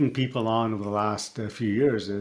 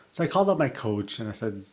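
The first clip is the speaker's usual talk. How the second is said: normal talking voice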